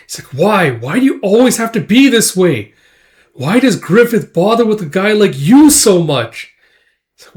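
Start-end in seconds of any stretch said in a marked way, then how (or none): 0.3-6.5 high, loud upset plea; acted hurt outburst, voice raised; tearful reproach. Casca, tearing up, berates Guts over Griffith, upset and loud. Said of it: "tearing up a little bit".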